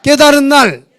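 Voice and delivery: 깨달은 날 loud and clear for the congregation to repeat; phrase for repeating. Shown as a demonstration. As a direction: say 깨달은 날 so they can repeat it. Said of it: "따라합니다".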